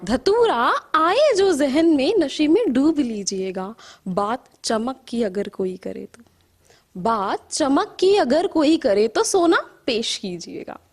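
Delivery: measured verse with rising and falling cadence; poetry recital. Acted. Poet reciting to an appreciative audience, measured poetry recital. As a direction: recite in lilting lines, letting line endings sink.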